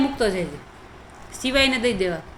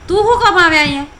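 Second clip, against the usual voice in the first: scolding; 4 semitones higher, 5 dB louder than usual. Real, not acted. Mother scolding son.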